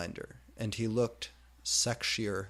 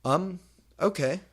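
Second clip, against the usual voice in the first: shrug; 9 semitones higher, 6 dB louder than usual. Acted. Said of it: "I shrugged".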